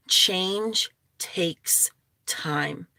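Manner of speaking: slow, breathy whispered message. Real, not acted. Urging viewers to be patient about change, slow whisper. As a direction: let it sink in with a slow whisper.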